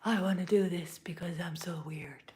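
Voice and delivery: breathy conspiratorial whisper, barely voiced; playful whisper. Reader whispering a silly confession to viewers, hushed whisper. Whispered aside during card reading.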